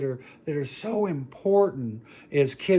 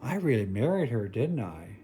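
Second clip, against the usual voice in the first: ordinary talk, nothing marked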